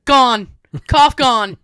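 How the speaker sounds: put-on loud, emphatic old man in clipped phrases; Tony. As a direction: imitate a grandfather proclaiming a cure. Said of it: "He goes".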